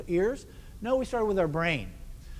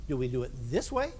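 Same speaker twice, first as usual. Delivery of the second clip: raised voice; 4 semitones lower, faster than usual. Shown as a demonstration. Conductor asking band how to add emphasis, raised voice. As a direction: lift the voice up on the final words. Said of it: "do we raise our voice".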